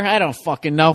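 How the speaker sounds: ordinary talk, nothing marked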